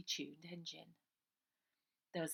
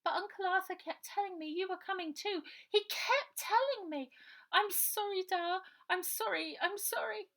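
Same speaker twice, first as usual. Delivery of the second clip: put-on much higher, small tearful girl's voice; little Bonnie; 14 semitones higher, 7 dB louder than usual. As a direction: plead like a guilty little sister. Bonnie apologising in tears.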